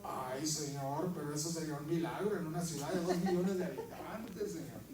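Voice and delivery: preacher mocking his own reluctance to God; mock reluctant plea. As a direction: whine it out half laughing. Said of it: "retold reply to God".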